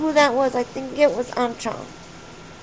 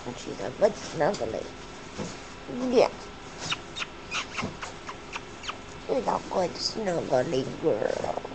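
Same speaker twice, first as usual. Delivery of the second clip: tender cooing; 8 semitones lower, 7 dB quieter than usual. Real, not acted. Owner cuddling her pet bird on camera, tender cooing.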